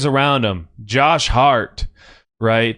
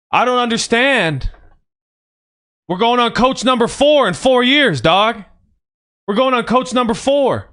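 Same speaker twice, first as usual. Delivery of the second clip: raised, heated rant; pissed off; 7 semitones higher than usual. Real, not acted. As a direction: fume at the front office, voice pushed up. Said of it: "I'm fucking pissed off right now".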